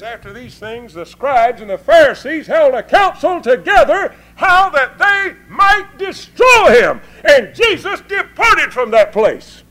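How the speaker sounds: high, loud, slow scripture shout; full-force preaching. Acted. Preacher reading Matthew to the congregation, full-force preaching. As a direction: drive the verse home.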